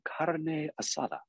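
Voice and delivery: put-on carne asada in a showy Spanish accent; mock over-correct Spanish accent; applied Spanish accent. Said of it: "the accent that they're applying to it".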